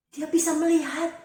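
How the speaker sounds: high, loud burst of excitement; joyful outburst. A narrator's reading. Narrator announces Bartimius can see, exclaiming excitedly. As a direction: exclaim it as a sudden miracle. Blind man suddenly sees.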